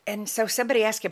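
ordinary delivery

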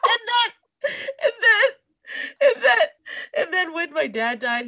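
high, loud, breaking with laughter and tears; laughing through tears. Real, not acted. Telling about her father's death, laughing through tears. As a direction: try to talk while laughing and crying.

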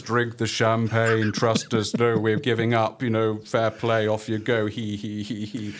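slow, booming villain voice on a monotone; drawn-out mock-menacing proclamation; Mysterons. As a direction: intone it slowly like an alien overlord, ending in a chuckle. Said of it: "this is the voice of the Mister Ron's".

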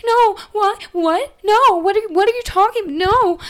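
high, loud frantic no's; panicked protest. Retold or imitated. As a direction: beg him in a rising panic. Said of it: "She's like".

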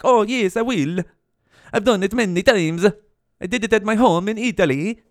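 Mario. Retold or imitated. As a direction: answer proudly in a mock Italian accent. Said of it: "I sound like fucking Mario right now".

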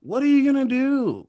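high, whiny mock challenge; mocking a rejected guy. Mocking the rejected friend's comeback, high whiny challenge. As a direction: play a sore loser squeaking back.

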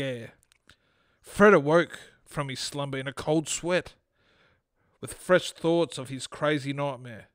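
story narration. Acted. Podcast host reads his short story to co-host, storytelling. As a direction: read the short story aloud, composed.

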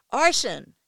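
loud pained cry of a name. A narrator's reading. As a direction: scream his name in pain. Crying out in pain.

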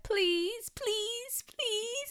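put-on very high, drawn-out child's pleading; sweet little voice. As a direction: beg like a sweet little niece. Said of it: "in this sweet little voice".

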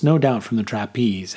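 plain reading voice

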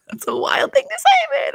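squeaky high-pitched disbelief; high squeal. Real, not acted. Reacting to Whitney's comment, squeaking in disbelief.

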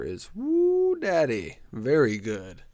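usual speaking voice

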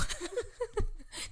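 a high, breathy burst of laughter. Real, not acted. Laughing at own plea.

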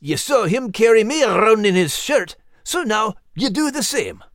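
high, lively character voice; urging companion. Acted. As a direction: urge the youngster to follow your example. Max instructs the young eagle.

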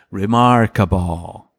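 slow, drawn-out catchphrase; lightly voiced kid drawing out each syllable; imitating TV kid. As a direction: stretch the word out slowly. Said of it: "there's this one kid".

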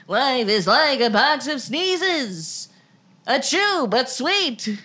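slow high mock drawl. Joking with a co-host, slow parody drawl. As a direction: drawl the line out slowly like a gentle hero.

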